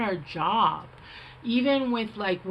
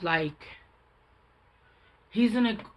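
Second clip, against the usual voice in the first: normal talking voice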